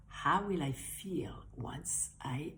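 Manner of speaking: soft, slow whisper to herself; hushed wondering whisper; whispered inner question. Pretend. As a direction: whisper it like a private thought. Inner question while visualizing.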